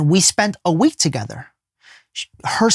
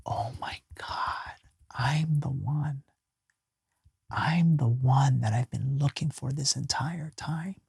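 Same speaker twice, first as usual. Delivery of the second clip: slow, much softer unvoiced whisper, close to tears; tearful whisper; 10 dB quieter than usual. Real, not acted. Overwhelmed by his revelation, a slow tearful whisper. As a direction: let it come out as a broken whisper of realisation.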